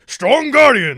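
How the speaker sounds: high, booming mock-villain shout; Ansem Heartless. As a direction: bellow it like a hammy game boss. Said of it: "the Ansem Heartless is like".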